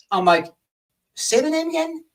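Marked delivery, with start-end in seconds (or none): 1.1-2.0 high, disbelieving retold ask; incredulous question. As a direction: act like you can't believe the name. Retold phone call with police.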